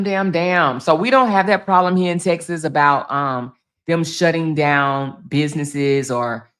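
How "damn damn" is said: ordinary talk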